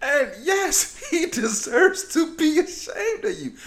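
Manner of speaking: real laughing agreement; laughing retort. Real, not acted. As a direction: crack up while agreeing.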